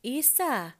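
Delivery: high, loud call for dad; child calling out. A narrator's reading. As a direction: shout for dad like a little boy. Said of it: "hän huutaa".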